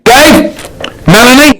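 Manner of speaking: loud high call of names, as if summoning; shouting at a fossil. Pretend. Shouting names at a fossil to make it answer, loud and high. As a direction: yell the names at the stone. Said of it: "you shout at it".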